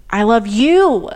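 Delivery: you punched loud, slow; I love you with the weight on you; stress on you. Shown as a demonstration. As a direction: lean on the word you. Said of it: "which word you put the inflection on".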